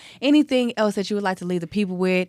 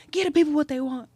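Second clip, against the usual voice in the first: hype call; 8 semitones higher, faster than usual. Real, not acted. Hyping up the guest.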